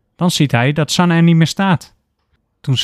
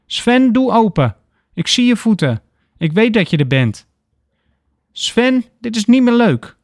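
urgent calling; 9 semitones higher than usual. A narrator's reading. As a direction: call out, getting upset. Banging on door, calling Sven.

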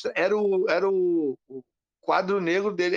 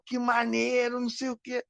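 imitating excitement; 8 semitones higher than usual. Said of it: "Aí o cara ficou empolgadão".

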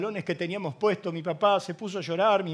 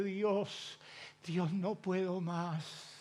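broken prayer; 3 semitones lower, 11 dB quieter, much slower than usual. Retold or imitated.

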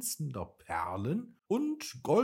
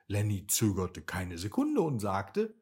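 ordinary delivery, nothing marked

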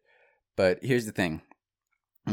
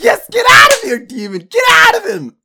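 shrieked, frenzied exorcism, very high and loud; ecstatic exorcist coach; 18 dB louder than usual. Retold or imitated. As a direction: scream the demon out in raptures. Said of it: "in ecstasy".

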